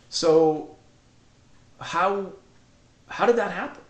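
high, tear-choked question; asking through sobs, voice pushed up high; sobbing question. Retold or imitated. Son sobbing as he asks his mother how it happened. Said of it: "I'm just sobbing".

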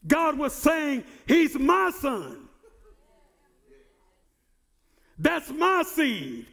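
rising, ringing sermon voice, pushing to shouts; full-force preaching. Acted. Pastor preaching the baptism of Jesus to his church, full force. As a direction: preach it hard, building to a holler.

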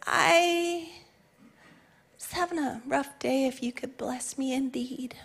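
soft, shrinking voice fading to a whisper; sheepish whispered request; timid mumble. Pretend. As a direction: mumble it, barely daring to ask. Timid prayer acted out.

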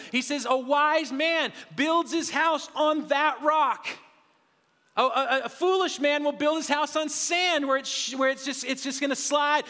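high, driving sermon voice that swells on the key lines; impassioned pulpit delivery, slowing down on the scripture; preaching at full force. Acted. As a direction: preach it out, pressing each promise home. Preaching with rising intensity.

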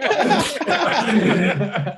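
long high burst of laughter. Real, not acted. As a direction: crack up laughing. Laughs at the story.

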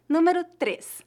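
ordinary talk, nothing marked

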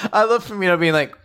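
ordinary talk, nothing marked

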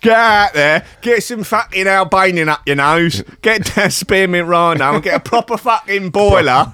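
hammed-up Cockney mate telling him to buck up; mock Cockney lad. Cockney friend urging his mate to liven up, brash. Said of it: "Cockney Jesus".

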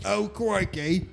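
high, drawn-out mock British accent. Co-host joining in the palace-window bit, exaggerated British accent. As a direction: stretch it out in a hammed-up British voice. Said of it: "That's my British accent".

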